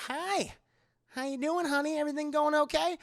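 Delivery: high, sing-song fake-friendly android; put-on chirpy bedside voice; mock cheery greeting. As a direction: coo it with overdone politeness. Acting David greeting the patient.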